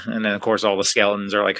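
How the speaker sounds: ordinary talk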